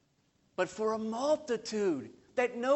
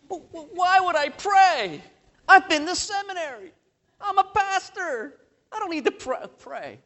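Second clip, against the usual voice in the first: mock huffy, higher and louder; indignant protest; 10 semitones higher, 5 dB louder than usual. Pastor protests to his wife that he needn't pray, mock indignant. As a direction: play the proud pastor, offended. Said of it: "I'm like".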